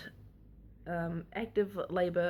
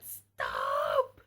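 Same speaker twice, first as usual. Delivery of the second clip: retold scream; 4 dB louder than usual. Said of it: "I just was screaming".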